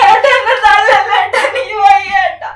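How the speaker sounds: loud, high sobbing; crying plea. Acted. Wife begging husband not to shove her, sobbing. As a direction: beg him not to push her.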